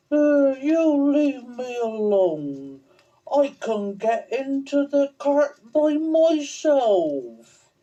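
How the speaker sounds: put-on high, slow, sulky child's voice; high slow whiny little boy. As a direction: pout it out like a stubborn small boy. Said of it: "a very sulky little boy".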